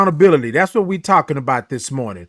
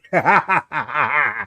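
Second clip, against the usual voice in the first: laugh. Real, not acted. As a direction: burst of laughter after clowning. Laughs at own silly greeting.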